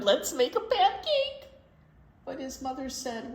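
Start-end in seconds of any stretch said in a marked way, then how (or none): 0.0-1.5 high, loud eager call; eager plea. Acted. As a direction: beg for the pancake. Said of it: "he shouted".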